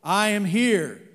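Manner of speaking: slow, loud, lightly voiced divine voice; voice from heaven. God answering the falling man from heaven, slow and grand. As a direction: speak down slowly as God. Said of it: "a voice from heaven".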